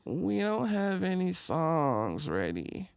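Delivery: slow, mopey mock of the friends; whiny friends. Mocking his bandmates' slow, sulky excuse. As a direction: drag it out like a sulking friend. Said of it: "They're like".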